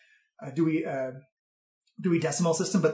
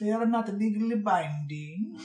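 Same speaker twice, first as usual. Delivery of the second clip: put-on haggling old bookkeeper; 5 semitones higher than usual. Game master plays old bookkeeper.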